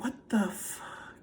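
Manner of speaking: slow, voiceless whisper; frightened whisper. Retold or imitated. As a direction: whisper it in stunned dread, drawn out. Frightened in bed, hearing footsteps.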